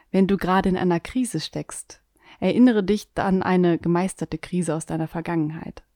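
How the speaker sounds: ordinary delivery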